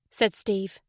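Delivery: ordinary delivery, nothing marked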